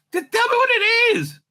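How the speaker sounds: high demanding voice. Acted. Recapping the show, voicing a captor in a high squeak. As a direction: push the question up into a high squeak. Acting out the Mind Flayer scene.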